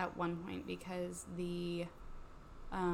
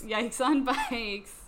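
slow loud catchphrase; drawn out; 5 semitones higher, 10 dB louder, much slower than usual. Pretend. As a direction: stretch every word out.

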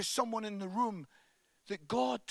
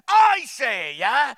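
much louder, a mock furious dad; retold shout; 3 semitones higher, 13 dB louder, slower than usual. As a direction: bellow it back at the kid. Dad shouting back at son.

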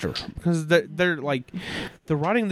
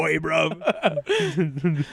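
bad London accent. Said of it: "doing the worst accent of all time".

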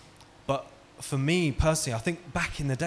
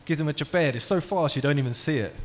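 normal talking voice

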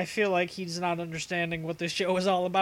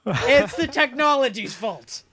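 mock excuse; 5 semitones higher, 8 dB louder, slower than usual.

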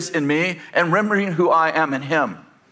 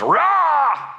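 mock roar; 11 semitones higher than usual. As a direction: pounce with a kid's roar. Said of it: "And she goes".

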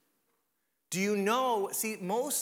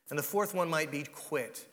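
ordinary delivery

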